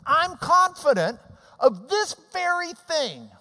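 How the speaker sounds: high, loud proclamation of Paul's words; emphatic quote. Acted. Preacher to congregation quoting Paul, loud and emphatic. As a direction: ring out Paul's confidence.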